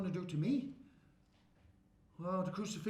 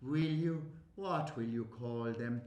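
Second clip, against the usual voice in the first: low, slow sarcastic question; mocking retort; 8 semitones lower than usual. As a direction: sneer the question back.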